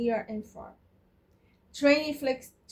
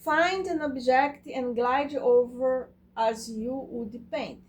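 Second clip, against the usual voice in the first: ordinary talk, nothing marked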